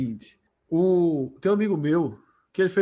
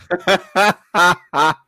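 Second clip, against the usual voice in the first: loud burst of laughter; big laugh; 9 dB louder than usual. Real, not acted. Punchline of betting story.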